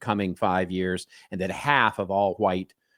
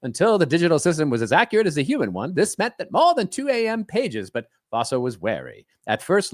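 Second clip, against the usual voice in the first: higher-pitched, swooping vintage announcer; old-timey radio announcer; 6 semitones higher than usual. Acted. Host reading a layoff article to co-host, as an old-timey announcer. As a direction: dramatize it like an old radio bulletin. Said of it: "I'm adopting an, uh, an accent for this".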